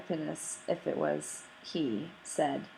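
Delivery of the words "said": ordinary delivery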